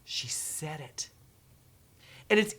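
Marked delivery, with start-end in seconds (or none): 0.0-1.1 quiet, slow, impressed murmur; hushed awe. Retold or imitated. Educators reacting to the video, quietly awed. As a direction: let it sink in softly. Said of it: "What they're gonna do is go".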